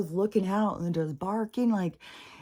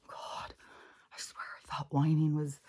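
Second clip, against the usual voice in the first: soft unvoiced grumble; half to herself, exasperated; whispered aside; 5 dB quieter than usual. Real, not acted. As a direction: owner whispering complaint about the puppy.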